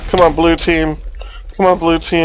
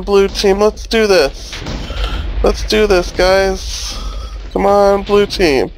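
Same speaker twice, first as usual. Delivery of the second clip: higher, urgent rallying cry; rally the team, pitched up; excited cheering; 6 semitones higher than usual. Real, not acted. Cheering team after losing.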